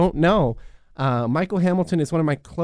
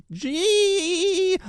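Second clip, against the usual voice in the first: ecstatic squeal; 13 semitones higher than usual. Acted. Excited squeal after the book deal.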